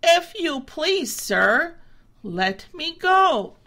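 high, slow polite begging; a humbled goat asking nicely; meek tired plea; pleading goat. A narrator's reading. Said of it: "he said".

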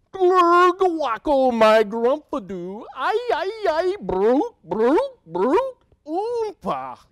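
high, loud babbling gibberish; gurgling alien voice. Acted. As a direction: gargle out alien nonsense. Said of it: "make silly sounds like".

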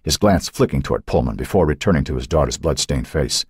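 plain reading voice

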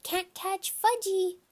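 put-on high, drawn-out toddler taunt; slow, high childish call; hollering. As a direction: tease in a high little-kid holler. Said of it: "he hollered".

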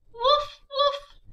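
put-on bouncy high puppy barks for kids. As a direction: bark like a little puppy. Dog barks in picture book.

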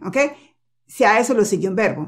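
ordinary delivery

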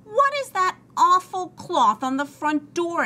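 high, loud, appalled question; appalled demand. A narrator's reading. Missus Rogers upset at ruined door.

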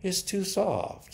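sighing. Acted. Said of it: "she sighed".

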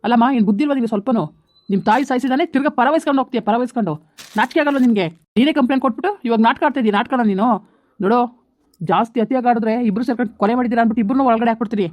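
loud, furious policeman berating her; shouting officer threatening jail; angry scolding. Acted. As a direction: bark threats at the pleading woman. Police officer scolds pleading wife.

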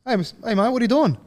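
mock coach voice pitched well up, rising to the question; imitating the coach. Coach ringing the player early in the morning, mock-suspicious question. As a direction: do the coach catching you out, high and pointed. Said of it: "He's like".

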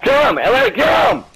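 urgent rising shout. Real, not acted. Urging teammate, rising shout.

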